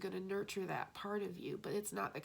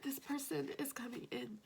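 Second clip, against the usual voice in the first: crying whisper; 6 semitones higher than usual. Real, not acted. Overwhelmed by the reading.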